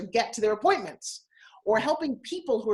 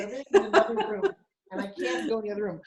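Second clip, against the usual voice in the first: laughing aside; faster than usual. Real, not acted. Laughing aside about the other room.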